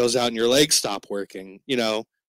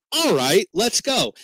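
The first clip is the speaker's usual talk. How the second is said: higher, chirpy go-along voice; naive eager agreement; 8 semitones higher, slower than usual. Retold or imitated. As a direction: agree cheerfully without a thought.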